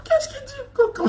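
high, wailing cry on the whole question; mock wail. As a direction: whine it out like a hurt child.

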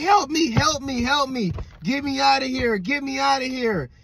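high, repeated mock cry for help; desperate plea. Bloodied woman begging a driver for rescue, desperate plea.

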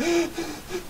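high, quiet frightened gasp; terrified gasp. Acted. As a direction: gasp as if clutching someone in fear. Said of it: "he's just like".

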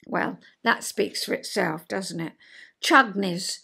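ordinary talk, nothing marked

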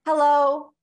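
high, loud hello on a held breath; top of the breath. Shown as a demonstration. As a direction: pitch it at the highest end of the range. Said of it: "say hello at the top of your breath".

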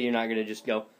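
ordinary delivery

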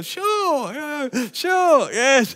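high, strained mock yes; squeaky bluffing yeses; fake agreement. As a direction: pretend you remember. Faking memory, mock agreement.

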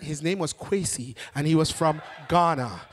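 ordinary talk, nothing marked

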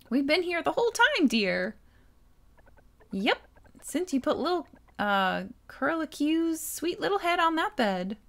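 a hint of a sweet, meddling dear; lightly voiced doting aunt.

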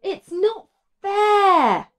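high, slow, whiny complaint; moaning Martha. A narrator's reading. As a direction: whine it like a fed-up sister. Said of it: "moaned Martha".

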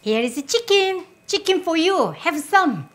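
bright high offer, chicken for you; sing-song, eager to share; excited offering. Real, not acted.

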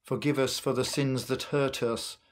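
ordinary delivery

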